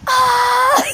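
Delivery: high, loud yell; mock scream. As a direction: scream into the phone. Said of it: "I just scream at the phone".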